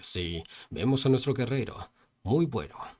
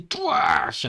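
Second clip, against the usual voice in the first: loud, high, drawn-out whoosh; comic-book attack noise; slash sound effect; 10 semitones higher, 6 dB louder than usual. Acted. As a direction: voice a surprise blade strike. Imitated sudden attack noise.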